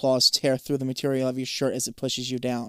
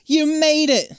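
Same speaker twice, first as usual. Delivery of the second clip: high, loud cheer; 13 semitones higher, 7 dB louder, slower than usual. A narrator's reading. Zoe sees you surface safely, cheering. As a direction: cheer them on at full voice. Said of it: "Zoe cheers".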